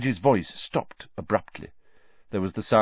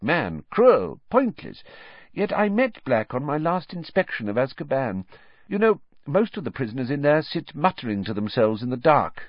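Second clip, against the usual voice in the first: higher official's voice recalling horror; pompous minister, shaken; 8 semitones higher than usual. Acted. Minister recalls the massacre to the landlady, shaken official voice. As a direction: play the minister haunted by the scene. Fudge character voice.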